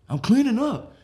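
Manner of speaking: loud, high-pitched excuse; defensive protest. Acted. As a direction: protest it like a caught houseguest. Houseguest protesting in acted quarrel.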